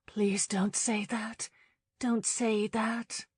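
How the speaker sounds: slow, breathy whisper; anguished woman whispering a plea to the man she loves. Acted. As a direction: plead in a whisper, barely holding on. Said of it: "she whispered".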